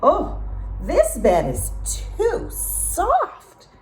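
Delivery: put-on high, slow, loud complaining girl; Goldilocks hollering. A narrator's reading. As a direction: drag out the complaint, calling out. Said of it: "she hollered".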